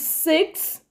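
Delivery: "six" said high and crisp, every sound clear; careful pronunciation of six. Shown as a demonstration. Teacher shows learners how to say "six" carefully. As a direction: model the word "six" slowly and cleanly. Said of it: "to pronounce with care, especially number six".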